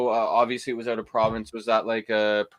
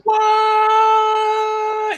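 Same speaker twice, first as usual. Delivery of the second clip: long, very high astonished what; shocked; 8 dB louder than usual. Real, not acted. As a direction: squeal it out in disbelief. Amazed at rare bottle.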